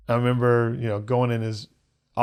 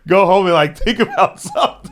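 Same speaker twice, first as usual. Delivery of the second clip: higher, louder, breaking into laughter; laughing; 7 semitones higher, 7 dB louder than usual. Real, not acted. Former student amused he actually obeyed his teacher, laughing. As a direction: crack up while finishing the thought. Amused at himself doing homework.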